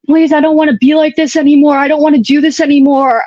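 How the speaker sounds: begging through tears, reliving it; retold crying plea. Patient in the ambulance begging paramedics, tearful plea. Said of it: "And I remember crying".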